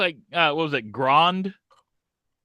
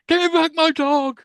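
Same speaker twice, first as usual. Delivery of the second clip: high, creepy Buffalo Bill squeal; 12 semitones higher, 3 dB louder than usual. Retold or imitated. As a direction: pitch way up for a creepy villain. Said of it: "like, Buffalo Bill callback there".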